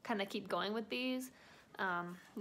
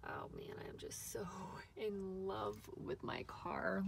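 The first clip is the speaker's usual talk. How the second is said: whispering in awe; 5 dB quieter than usual. Real, not acted. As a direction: hush it out lovingly. Admiring her new car.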